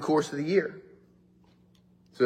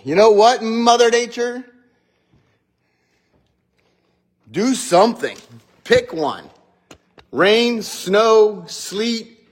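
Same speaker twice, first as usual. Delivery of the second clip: louder and higher, mock-scolding the sky; yelling at Mother Nature; 6 semitones higher, 5 dB louder, slower than usual. Pastor scolds Mother Nature over mixed weather, higher louder mock yell. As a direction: demand weather like a fed-up parent. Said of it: "yelling at Mother Nature".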